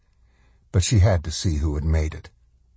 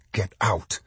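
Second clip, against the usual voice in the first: plain reading voice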